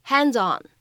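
"Hands on" as "han-zon"; linked hands on. Shown as a demonstration. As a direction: run "Hands on" together. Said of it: "我们可以读成".

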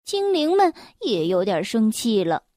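plain reading voice

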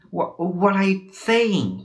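high, slow cry of distress; acted pained outcry. A narrator's reading. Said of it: "cried Wukong".

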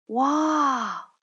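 high, loud gasp of wonder; astonished exclamation; amazed wow. A narrator's reading. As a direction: marvel at the snow. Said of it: "惊叹道".

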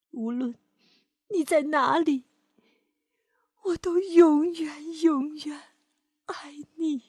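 grieving mother, gently; mother's sad farewell. Acted. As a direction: say goodbye to a lost child.

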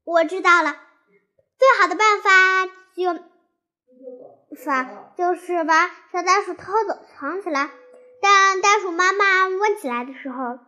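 loud eager idea; blurting out a clever plan; excited. Acted. 兔子兴奋地说出主意. (兴奋地大声说)

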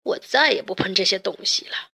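lightly voiced discouraged father; a touch of defeat in a character voice; dejected. A narrator's reading. Inventor gives up on his broken machine, mildly dejected. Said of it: "他沮丧地说".

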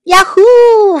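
high, loud, drawn-out holler; loud high cheer on takeoff; excited whoop. A narrator's reading. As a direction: whoop it out joyfully. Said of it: "followed Jack".

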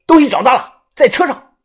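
high, loud call across the room; shouting. Acted. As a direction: call out the find. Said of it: "大声地说". (大声喊)

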